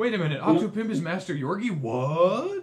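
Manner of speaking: mind-blown follower. Acted. Voicing a YouTube follower losing his mind, mock astonishment. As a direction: act out a fan stunned by the revelation. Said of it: "is losing his mind".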